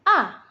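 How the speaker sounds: "A" falling sharply, as if angry; short "A" with a hard downward stroke; fourth tone. Shown as a demonstration. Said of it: "seperti itu".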